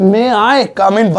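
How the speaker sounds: may I come in said slowly and clearly; slow model phrase. Shown as a demonstration. Teacher modelling a request slowly for students. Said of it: "teaching the phrase".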